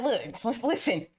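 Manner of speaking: insistent retort. Retold or imitated. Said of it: "I was like".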